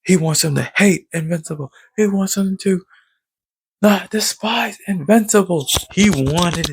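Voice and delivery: high, worked-up retelling; excited emphasis. Real, not acted. Fan explaining Angstrom's plan, high and excited. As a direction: get worked up over the villain's plan.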